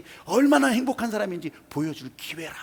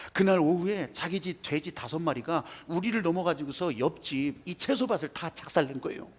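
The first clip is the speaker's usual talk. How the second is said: ordinary talk, nothing marked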